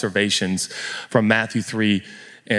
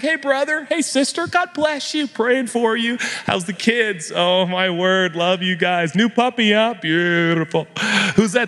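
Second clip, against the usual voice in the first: a hint of a cheery pastor greeting; friendly pastor; 9 semitones higher than usual. Pastor voicing the ideal friendly greeter, lightly voiced. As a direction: greet everyone like a beaming pastor. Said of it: "supposed to be the guy that's like".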